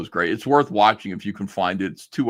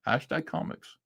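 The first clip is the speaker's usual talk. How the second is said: "Hashtag comics" pitched higher and much softer, rising at the end; amused mock echo; 6 semitones higher, 7 dB quieter than usual. Repeating Liam's sign-off to viewers, softly mocking. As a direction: echo the words back under your breath, amused.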